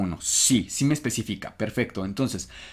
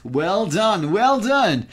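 rising, higher congratulation, second one louder; excited praise; 7 semitones higher, 5 dB louder, much slower than usual. Real, not acted. Teacher congratulating student on the answer, building excited praise. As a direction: celebrate the right answer, building in pitch.

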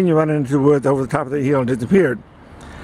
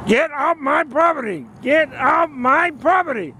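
retold yelling; 12 semitones higher, slower than usual. Neighbor ordering him off from the window, high mock yelling. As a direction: imitate an angry neighbor yelling, pitched way up. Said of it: "he starts yelling at me".

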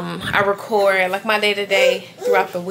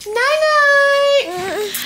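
put-on very high sing-song night night for the baby; 4 dB louder than usual. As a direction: sing it high like a bedtime coo.

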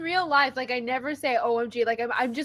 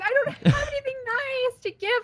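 mock whiny; 12 semitones higher than usual. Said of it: "I was like".

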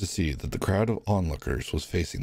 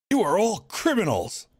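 much higher, angry accusing shout; furious warden; 13 semitones higher, 3 dB louder than usual. Acted. Warden condemning the prisoners, angry shout. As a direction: condemn them all.

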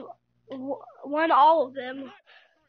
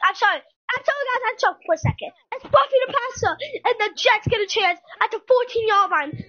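higher, excited sportscaster calling the drive; play-by-play call; 4 semitones higher, 3 dB louder than usual. Acted. Gamer calling his own game for viewers, excited announcer voice.

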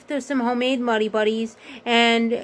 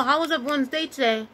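talking while chewing; eating chips between words; mouth full; 4 dB quieter than usual. Real, not acted. Seen 1: Hand puts snack into mouth at 1.4–1.7s while talking.

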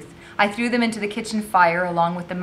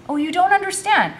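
high, loud objection; frustrated protest; 13 semitones higher, 6 dB louder than usual. A narrator's reading. Boy arguing back at the girl, frustrated. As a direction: protest that you are being misread.

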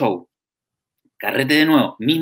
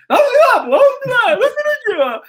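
shrill high yelling at the driver; drunk yell; 7 dB louder than usual. Retold or imitated. Drunk passenger yelling where the car is going, very high. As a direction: holler it, half crying. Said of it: "el Edo se reía".

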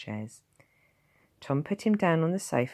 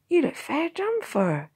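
motherly village wife; 6 dB louder than usual. A narrator's reading. Blacksmith's wife voiced.

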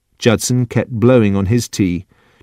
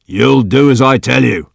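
put-on firm command from an old master; stern old man; 3 semitones higher, 4 dB louder than usual. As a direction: put your foot down, mildly raised. Old man orders Judson about.

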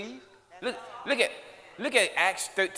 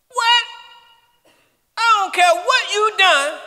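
very high, loud preacher's cry; preaching at full force; 13 semitones higher, 11 dB louder, slower than usual. Acted. Preacher crying out that sin is cleared. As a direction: cry it out slowly to the crowd.

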